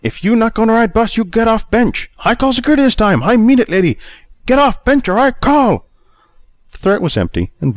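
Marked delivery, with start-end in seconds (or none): 0.0-5.9 much higher, loud broken-English bluster; Chinese-accented driver. A narrator's reading. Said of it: "the way he would deliver these words".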